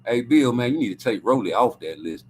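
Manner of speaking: low, hushed pleading voice; imagined pitch to Bill. Pretend. Him talking Bill out of Rolly, low and coaxing. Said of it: "Go through the list and be like".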